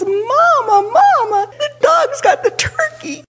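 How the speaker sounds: high-pitched mock scream for Mama; retold scream. Child calling Mama as the dog gets the turkey, mock screaming. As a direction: act out a child's panicked cry without full volume. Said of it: "screaming at the top of my lungs".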